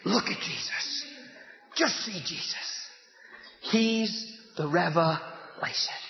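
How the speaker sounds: low, soft and slow; tender hush. Real, not acted. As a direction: draw them in gently. Tender sermon moment.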